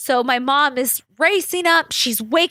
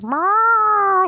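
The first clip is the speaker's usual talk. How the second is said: cat meow; 9 semitones higher than usual. Retold or imitated. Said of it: "Smokey is meowing".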